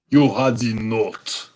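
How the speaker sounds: put-on slow, harsh German-accented question; a stern German count, measured; deep, harsh German accent, slowed down. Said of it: "he asked with a deep, harsh voice and a strongly marked German accent".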